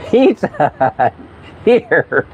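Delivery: high, laughing, drawn-out amazement; can't believe it, laughing as you say it; laughing disbelief. Real, not acted. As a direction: laugh through the words in disbelief. Amused disbelief at Pizza Hut.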